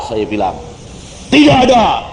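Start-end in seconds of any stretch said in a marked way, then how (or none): 1.3-2.1 dismissive father. Retold or imitated.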